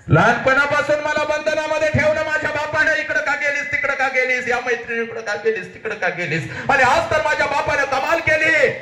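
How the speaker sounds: high, rapid declamation; soaring acted grievance; aggrieved outburst. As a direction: pour out the complaint in a high cry. Daughter's complaint cried out.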